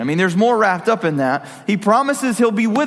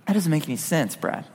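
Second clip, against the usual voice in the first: softer, lower, mock sceptical listener; doubting listener; 4 semitones lower, 5 dB quieter than usual. Listener tells Brad he's crazy, soft mock scepticism. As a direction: quietly scoff at the preacher as the doubter.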